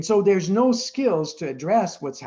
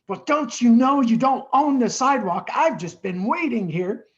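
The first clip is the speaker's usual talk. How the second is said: higher, louder indignant rebuke; indignant scolding; 6 semitones higher, 5 dB louder than usual. Retold or imitated. Old lady scolding a man blocking the sidewalk, indignant and loud.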